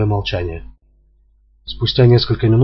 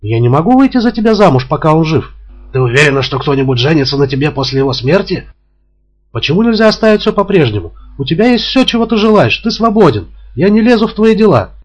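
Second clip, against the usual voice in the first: high, loud desperate woman; pleading young woman; 7 semitones higher, 4 dB louder than usual. A narrator's reading. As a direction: beg him, voice raised high. Narrator voices the woman. (высокий громкий голос отчаявшейся женщины)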